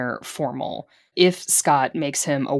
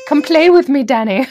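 high, loud sing-song taunt; creepy playmate call; 10 semitones higher, 10 dB louder than usual. Acted. Villains luring the boy in the locker room, creepy call. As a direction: call it out like a horror-film child.

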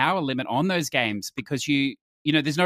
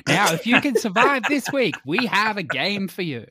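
mock announcer; 6 semitones higher, 3 dB louder than usual. Presents mock contest announcement.

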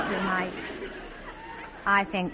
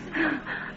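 sharp breathless gasp of delight; joyful gasp; 6 semitones higher, 5 dB quieter than usual. Acted. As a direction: catch your breath in happy surprise. Overjoyed at Dexter's proposal.

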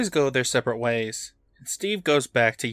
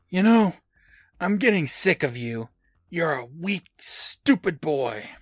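sneering mask voice; 4 semitones higher than usual. Retold or imitated. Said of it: "Steve stands up and says".

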